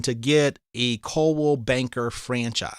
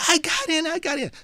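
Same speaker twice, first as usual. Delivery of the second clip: high, excited repeat of the good news; joyful exclaiming; 14 semitones higher than usual. Retold or imitated. Grandson telling grandma he got into Yale, joyful. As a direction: tell grandma the news, bursting with joy. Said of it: "And I said".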